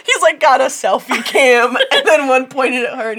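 cracking up while telling it; loud, giggly, barely holding together; laughing. Real, not acted. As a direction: talk through real laughter, high and loud. Cracking up describing the cameras.

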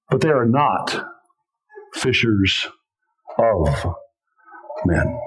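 low, slow, with long pauses between words; solemn slow weight on not fishers of men; drawn-out emphasis. Real, not acted. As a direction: let each word fall heavy and alone. Preacher slows for dramatic emphasis.

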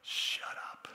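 whispered shut up; mock-stern whisper to the storm; blunt hushed command. Shown as a demonstration. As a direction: breathe it out as a hissed whisper. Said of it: "A nice way of saying it is this way".

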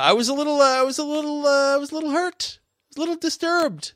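high, wounded whimper; mock hurt whine. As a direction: play the wounded party.

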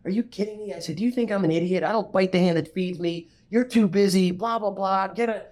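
high, heated mock shouting; retold yelling back. Yelling back at his future partner on the phone, high heated mock shout. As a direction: replay your own angry outburst at him. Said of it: "And I yelled back at him".

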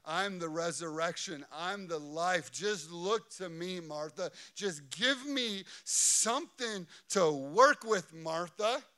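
insistent, measured entreaty; Jesus urging Martha. Acted. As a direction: plead with her to trust you. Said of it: "Jesus is saying".

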